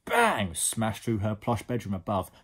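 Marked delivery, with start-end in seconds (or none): 0.1-0.5 loud, high "bang" falling away; explosive storybook bang; exclaimed crash. A narrator's reading. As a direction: shout it like an impact.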